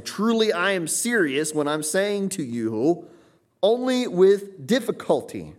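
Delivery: slow, high, insistent, hitting TRULY and ONLY; stated emphasis. Shown as a demonstration. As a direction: spell out how serious it is, drawing out TRULY and ONLY. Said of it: "have to state your emphasis".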